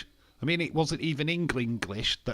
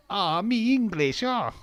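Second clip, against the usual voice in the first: "me English" in a higher, louder, slow put-on foreign accent; mock accent, hammed up; 9 semitones higher, 7 dB louder, slower than usual. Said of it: "Let's not do a racist accent".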